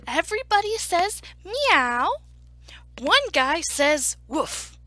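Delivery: loud, deliberate laying-out of rules; emphatic announcing. Acted. Story narrator giving animals the new plan, loud and emphatic. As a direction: announce the plan, punching each sound.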